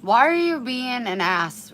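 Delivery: loud exasperated scold. Real, not acted. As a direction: tell the dog off. Scolding dog.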